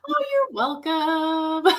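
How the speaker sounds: high, drawn-out, pleased reply; playful gushing thanks-taking. Retold or imitated. Actress accepting director's thanks for mooing, playfully drawn out. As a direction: stretch it out high and sing-song.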